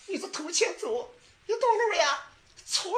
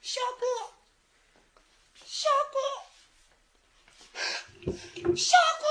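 calling out; 10 semitones higher, faster than usual. Acted. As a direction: call out for him, more urgent each time. 寻人呼唤小哥. (一声比一声高的呼唤)

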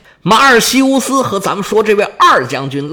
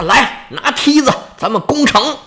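acted military order; 6 semitones higher than usual. A narrator's reading. Second general orders the assault.

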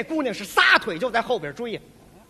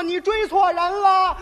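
shouting back; 6 semitones higher, 5 dB louder, slower than usual. Acted. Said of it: "我弟弟说".

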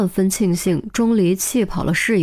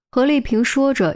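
ordinary delivery, nothing marked

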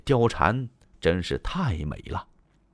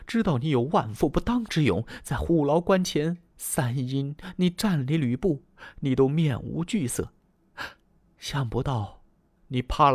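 angry; 6 semitones higher than usual. Acted. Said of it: "貂蝉一听生气了".

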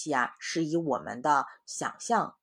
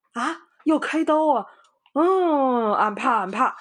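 much higher and louder, panicky; frightened pig; 12 semitones higher, 11 dB louder, slower than usual. Acted. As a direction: squeal in fright at the knife. Pigsy scared of surgery. (猪八戒害怕开刀的尖声)

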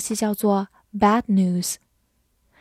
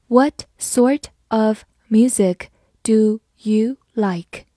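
slow, careful model reading; slower than usual. Shown as a demonstration. Teacher reading slowly to the class. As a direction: read it slowly for learners. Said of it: "好，慢慢来读".

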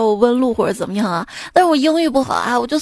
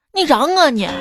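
higher, punchy Xi'an dialect; 4 semitones higher than usual. Shown as a demonstration. Host translating "kidding me" from English, punchy Xi'an dialect. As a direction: challenge them like a Xi'an local. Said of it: "当英语遇到西安话".

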